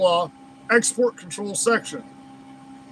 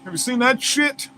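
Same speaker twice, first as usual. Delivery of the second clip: usual speaking voice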